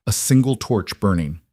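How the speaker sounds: ordinary delivery, nothing marked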